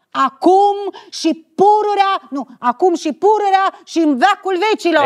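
solemn curse. Acted. Actress cursing her lover in rehearsal, rising solemn curse. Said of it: "Exact, blestem".

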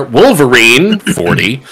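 normal talking voice

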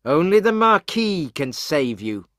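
acted accusing crowd voice; stern warning. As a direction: warn a prisoner forcefully. Angry crowd threatens jailed servant.

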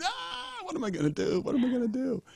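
high, mock-anguished wail; a hammed-up despairing cry to himself; mock panicked wail. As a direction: act out your own 3am panic in a thin high voice. 3am anguish, imitating himself.

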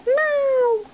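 very high squeaky answer; squeaky guinea pig voice. Pretend. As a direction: squeak it like a tiny pet.

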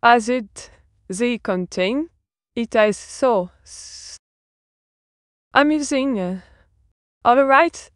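French-accented English, lilting up and down; playful French accent. Real, not acted. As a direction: keep up the French flair in every word. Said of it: "I've returned to my French flair".

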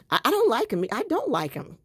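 teasing mock dislike; joking denial. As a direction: playfully take it back, loud and high. Jokingly retracting praise of husband.

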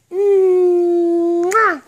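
big smacking kiss; loud kiss smack. Real, not acted. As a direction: blow a loud noisy kiss. Mother kissing her baby.

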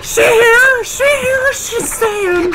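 high, whiny disbelief; excited squeal. Real, not acted. Amazed her fighter survives.

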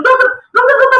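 high-pitched panicked call for the doctor; retold urgent call. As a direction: call out for help, acting it out.

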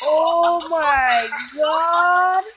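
long slow loud Oh my god; drawn-out amazed cry. Real, not acted. Vlogger stunned by a wild clip, slow and loud. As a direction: stretch it out in disbelief.

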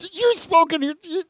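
freaked-out robber. Retold or imitated. Burglar startled by the talking parrot, in mock panic.

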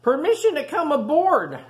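high, loud call up to the deck; eager shout. Acted. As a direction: shout it up to the ship. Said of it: "shouted Rufus".

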